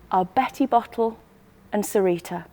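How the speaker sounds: usual speaking voice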